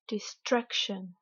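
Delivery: "destruction" in English, weight on STRUC; teacher's over-stressed "destruction", falling after STRUC; exaggerated English stress. Shown as a demonstration. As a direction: lean on STRUC in "destruction", de-STRUC-shun. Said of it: "je vais délibérément exagérer".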